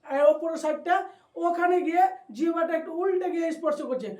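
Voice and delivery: ordinary delivery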